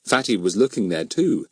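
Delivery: ordinary delivery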